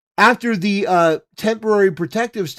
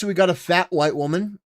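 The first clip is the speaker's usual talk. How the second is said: ordinary talk, nothing marked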